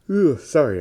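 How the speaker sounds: talking through a yawn; words stretched by a real yawn. Real, not acted. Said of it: "I'm yawning".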